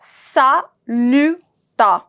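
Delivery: saluta stretched slowly, syllable by syllable; slow French syllables. Shown as a demonstration. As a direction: draw saluta out slowly to teach it. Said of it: "It's going to be".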